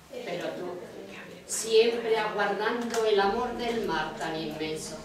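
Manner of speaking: measured, even poem recital; poetry reading. Acted. Reader sharing the orange chakra sea poem, measured recital. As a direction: read in unhurried phrases, each settling at its end.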